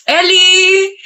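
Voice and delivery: high-pitched call of a name. Acted. Friend calling out to Ellie, high call.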